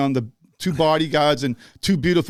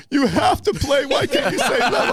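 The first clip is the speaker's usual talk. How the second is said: high, panicked plea; retold terror; 7 semitones higher than usual. Tour manager pleads with singer to play the hit, terrified. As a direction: imitate a frightened tour manager begging urgently. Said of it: "he's, like, terrified".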